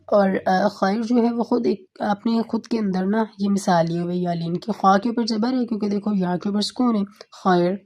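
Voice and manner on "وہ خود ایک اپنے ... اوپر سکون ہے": ordinary talk, nothing marked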